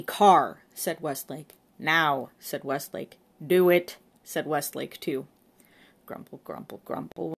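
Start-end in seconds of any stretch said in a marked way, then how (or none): 0.0-4.0 loud curt commands; barked orders. A narrator's reading. Inspector barking orders. 6.0-7.3 grumbling. A narrator's reading. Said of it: "went the chastened constable".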